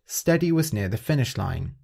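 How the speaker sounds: plain reading voice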